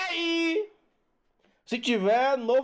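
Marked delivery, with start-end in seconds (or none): 0.1-0.7 "ei" held high with an open e; open diphthong. Shown as a demonstration. Teacher showing the open diphthong, "ei" long and high. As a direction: stretch "ei" high, opening the e wide. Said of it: "ei, não".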